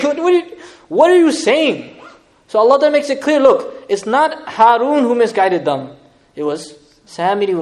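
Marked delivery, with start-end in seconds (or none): 0.0-2.0 laughing disbelief. Real, not acted.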